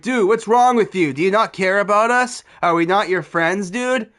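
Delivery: loud, high-pitched scolding tirade; imitated drill-sergeant outburst; drill instructor yelling. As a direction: fire off accusing questions at full volume. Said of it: "just goes full drill instructor on me".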